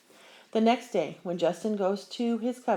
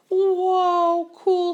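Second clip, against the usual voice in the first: much higher and louder, wowed; amazed; 12 semitones higher, 9 dB louder than usual. Acted. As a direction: blown away by the picture. Kids see amazing photograph.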